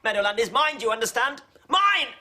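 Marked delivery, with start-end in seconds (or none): 1.7-2.1 high, loud shriek of a word; shouting. Acted. Neighbour shouting over the disputed field. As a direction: scream the claim at him. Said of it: "Please don't shout".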